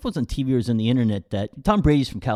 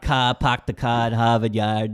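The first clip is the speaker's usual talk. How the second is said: park the car at Harvard Yard with dropped R's; mock Boston accent. A man teasing people from Boston, mock Boston accent. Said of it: "They do drop their R's".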